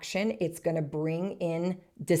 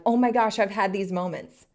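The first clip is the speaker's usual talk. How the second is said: acted delighted discovery; imagined listener's realisation; 3 semitones higher, 5 dB louder than usual. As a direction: exclaim it with sudden excitement. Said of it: "going like".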